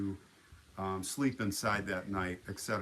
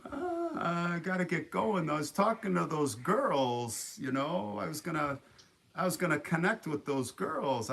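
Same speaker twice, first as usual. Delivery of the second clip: put-on reluctant kid; 7 semitones higher than usual. Kid telling the drone owner he must go, reluctant. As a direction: imitate a teen hedging to get away.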